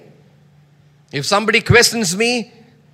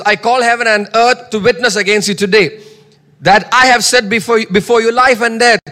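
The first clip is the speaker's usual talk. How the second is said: loud, firm proclamation; bold declaration; 3 semitones higher, 4 dB louder than usual. Shown as a demonstration. Preacher modeling bold counsel for the listeners. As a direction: speak it like a charge you mean. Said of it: "we must talk like this".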